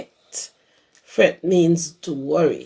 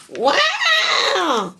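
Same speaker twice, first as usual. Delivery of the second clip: much higher crying wail; loud wail. Acted. Baby llama cries loud, high wail. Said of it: "starts to cry loud".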